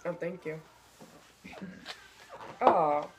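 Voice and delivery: usual speaking voice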